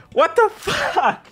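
high, slow incredulous what the fuck; high, drawn-out disbelief; stunned disbelief. Real, not acted. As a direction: drag it out in disbelief. Disbelief at dub.

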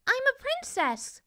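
high thin princess voice. A narrator's reading. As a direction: answer in a small high voice.

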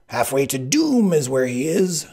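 high, loud, triumphant Sicilian; gloating cry. A narrator's reading. Sicilian crows over pursuer.

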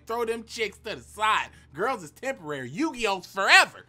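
rising mock-serious rallying shout; hyped shout. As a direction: yell it like a battle cry. Hyped rule shouted to viewers.

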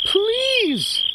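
high squeaky shouted "Please"; mouse shouting. Acted. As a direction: shout up at the noisy crickets. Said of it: "shouted the mouse".

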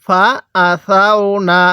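syllable-by-syllable recitation, measured and drawn out; slow model reading. Shown as a demonstration. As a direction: recite slowly for learners to copy. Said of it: "কিভাবে পড়ব?".

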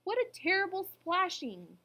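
high, loud dismay; frowning alarm. A narrator's reading. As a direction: exclaim as a worried elephant. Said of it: "the elephant frowned".

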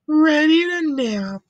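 ordinary delivery

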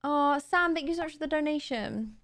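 ordinary delivery

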